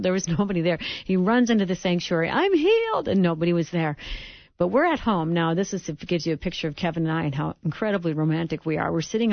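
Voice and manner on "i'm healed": high, loud cry of joy; joyful shout. Retold or imitated. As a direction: shout it out, overjoyed. Son shouting in sanctuary.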